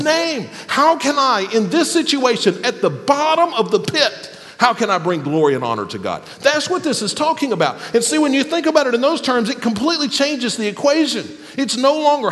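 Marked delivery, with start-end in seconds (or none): none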